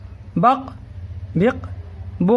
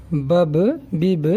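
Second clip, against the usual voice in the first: بب، بب said very slowly, each syllable drawn out; slow syllable demo; much slower than usual. Shown as a demonstration. Teacher slowly sounding out بب for pupils. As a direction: stretch out بب، بب for the class. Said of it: "جیسے یہاں دیکھیں آپ".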